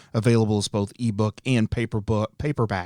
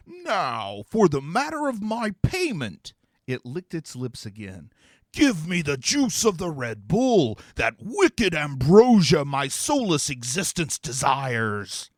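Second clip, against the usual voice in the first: greedy demon; 3 semitones higher than usual. Acted. Demon to Constantine, greedily demanding its payment. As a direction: savour the craving, ham it up.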